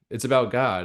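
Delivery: ordinary talk, nothing marked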